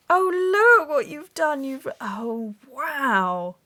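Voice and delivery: high, loud burst of delight. Real, not acted. Crafter thrilled by partner's houses, high loud exclaim.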